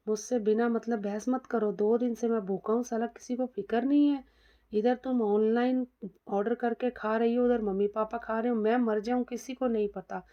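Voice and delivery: ordinary delivery, nothing marked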